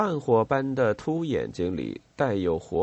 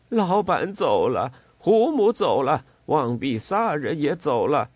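higher, louder, on the edge of sobbing; tearful lament; 5 semitones higher, 4 dB louder than usual. A narrator's reading. Pantacha laments to the officers, higher and tearful. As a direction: wail the losses one after another. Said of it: "潘达恰抽泣起来".

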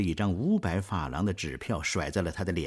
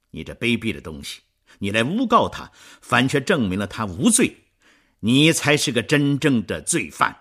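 louder and rising, fast then shouting; furious denunciation; 3 semitones higher, 8 dB louder than usual. Acted. Marius denouncing Thénardier, building furious shout. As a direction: rage building at the liar.

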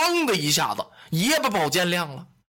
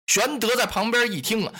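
plain reading voice

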